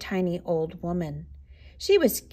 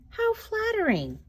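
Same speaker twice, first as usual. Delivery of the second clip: very high, flattered exclamation; delighted cry; 12 semitones higher than usual. A narrator's reading. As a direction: coo it high with pleased surprise. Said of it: "cried the tiny old woman".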